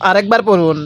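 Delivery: normal talking voice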